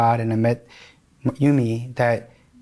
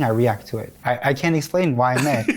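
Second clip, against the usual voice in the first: ordinary talk, nothing marked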